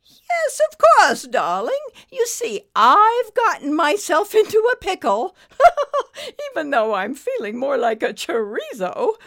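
high, loud British gush with laughter; amused British dame, projected; chuckling British lady. A narrator's reading. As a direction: chuckle grandly at your own predicament. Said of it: "The snake chuckled".

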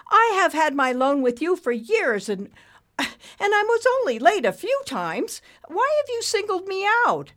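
very high, loud protest; pleading borrower. A narrator's reading. Borrower asking banker about his loan, pleading. Frank's character voice.

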